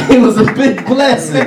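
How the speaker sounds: high, laughing testimony. Real, not acted. Preacher celebrating a blessed year, laughing. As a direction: let real laughter carry the words.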